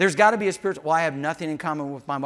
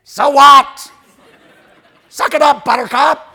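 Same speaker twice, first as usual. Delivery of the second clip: very loud, high dismissive yell; mock scornful shout; 9 semitones higher, 15 dB louder than usual. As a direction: bellow it like a friend with no sympathy. Acting unsympathetic friend shouting back.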